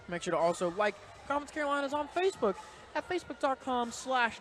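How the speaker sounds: upbeat, brisk sponsor announcement; bright promo voice plugging the conference channels; promo read. Acted. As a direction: read the social media plug like a radio spot. Sponsor read before the match.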